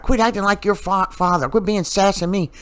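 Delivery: high-pitched lightly voiced mother scolding; mock scolding mother. Mother scolds her son for acting like his father, high mock nag. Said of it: "And then she's like".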